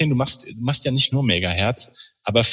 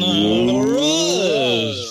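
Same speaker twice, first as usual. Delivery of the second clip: high, drawn-out name call; excited announcement; 10 semitones higher than usual. Real, not acted. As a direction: announce the guest like a showman. Host introduces guest with fanfare.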